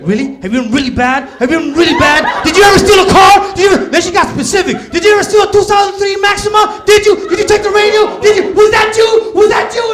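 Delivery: shouting high and loud, firing accusations; furious interrogation. Retold or imitated. Cop girlfriend yelling accusations at him, furious shouting. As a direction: yell each question like a furious cop.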